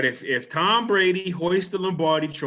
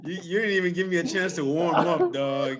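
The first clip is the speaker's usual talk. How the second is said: laughing protest; 3 semitones higher than usual. Real, not acted.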